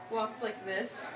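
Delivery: ordinary delivery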